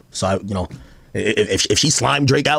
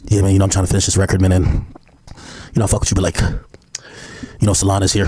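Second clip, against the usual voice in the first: imitating [name]; 6 semitones lower than usual. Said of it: "why you sound like this".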